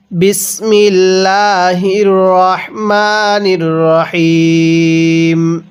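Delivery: drawn-out Quranic recitation; slow recitation. Shown as a demonstration. Teacher opens the lesson with slow Quranic recitation. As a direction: chant it slowly and reverently.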